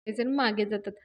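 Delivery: plain reading voice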